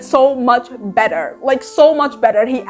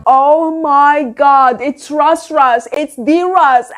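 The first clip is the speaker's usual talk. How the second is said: slow, drawn-out astonished retelling; stunned recognition; much slower than usual. Retold or imitated. Reviewer retelling how she recognised Russ, stunned. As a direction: stretch it out as it dawns on you.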